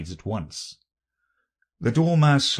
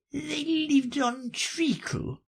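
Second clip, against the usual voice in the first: dormouse voice; slower than usual. A narrator's reading.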